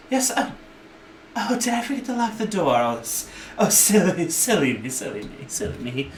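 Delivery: high, flustered little gnome woman; nervous shopkeeper shooing people out. Acted. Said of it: "gnome woman's like".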